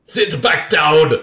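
slow, loud hammed-up order; mock movie yell. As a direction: bark it out like an over-the-top actor. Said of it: "That's a Nicolas Cage move right there".

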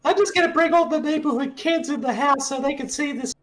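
high-pitched put-on character voice; squeaky voiced kid character. Voicing movie character.

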